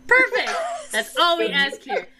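high, loud laughing "Perfect!"; gleeful game master praising the awkward dancer; delighted. Real, not acted. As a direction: cheer them on with delight. GM delighted at player.

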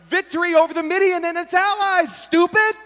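urgent, exasperated friend; sober, alarmed friend. Retold or imitated. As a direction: snap him out of it with alarm. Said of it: "who was clearly sober".

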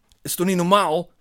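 loud, high-pitched indignant question. A narrator's reading. Bar acquaintance challenges the narrator, indignant.

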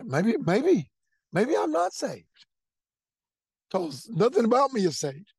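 startled inner voice, building; dawning realization. Retold or imitated. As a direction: voice climbs as it hits him that he may not be saved. Retelling a sudden realization.

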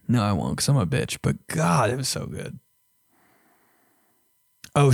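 ordinary talk

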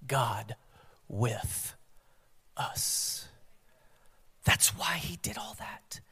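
slow, hushed whisper. Real, not acted. Preacher revealing Emmanuel to congregation, whispered. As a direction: let each word fall softly apart.

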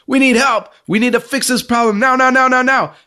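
panicking, insisting it be fixed now; hammed-up panic; frantic Miriam. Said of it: "She comes in cussing".

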